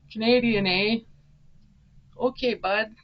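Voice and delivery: playful mock Canadian; hammed-up Canadian, joking; Canadian accent. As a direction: lay on a fake Canadian accent. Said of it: "It sounds Canadian".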